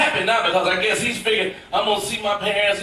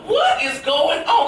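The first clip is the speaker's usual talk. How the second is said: high, slow baffled question; can't believe what he's hearing; baffled at the funeral news, slow and high; stunned disbelief; 7 semitones higher, slower than usual. Retold or imitated. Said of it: "I'm thinking to myself".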